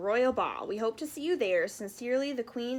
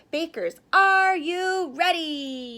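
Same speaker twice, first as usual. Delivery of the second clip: slow, louder, higher call; yelled; 6 semitones higher, 7 dB louder, slower than usual. Acted. Kitchen director calling to the bakers, a slow loud yell. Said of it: "yelled Chocolate Chip".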